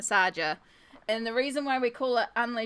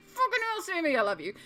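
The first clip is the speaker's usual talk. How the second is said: excited; 10 semitones higher, faster than usual. Retold or imitated. Retold reaction to gift.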